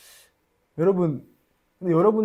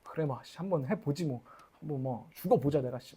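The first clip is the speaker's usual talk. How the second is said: a soft grumbling mock vow; muttered defiance; 3 semitones lower, 8 dB quieter than usual. Pastor imitating his own stubborn vow to fast, softly. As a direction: grumble it under the breath. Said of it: "도끼에서 막 올라오는 거예요".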